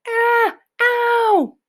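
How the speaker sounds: high, loud anguished cry from a giant; a nightmare-struck giant howls out; giant's high cry. A narrator's reading. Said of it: "roared the flesh lump eater".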